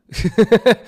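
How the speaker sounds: bursting out laughing, high and loud; big laugh at his own joke. Real, not acted.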